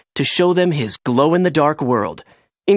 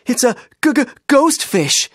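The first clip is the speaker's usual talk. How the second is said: frightened stammer; 9 semitones higher than usual. A narrator's reading. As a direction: stutter with fright, pitched way up. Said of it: "quivering in fright".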